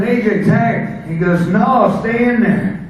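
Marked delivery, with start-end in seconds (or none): 0.0-1.0 higher, slowed call to his partner; tired wrestler begs his partner for a tag, calling out. Retold or imitated. 1.5-2.8 higher, slow, louder refusal; retold refusal. Partner refuses tag.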